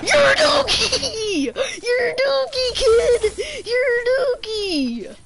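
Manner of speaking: high, drawn-out yelling taunt laced with laughter; screaming taunt. Real, not acted. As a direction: stretch every word out and scream it at him. Taunting the opponent he beat.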